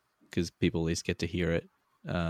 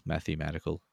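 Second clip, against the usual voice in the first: ordinary talk, nothing marked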